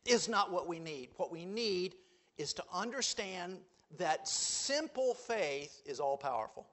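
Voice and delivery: normal talking voice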